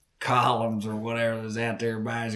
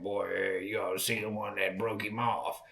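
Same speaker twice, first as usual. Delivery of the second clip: ordinary talk, nothing marked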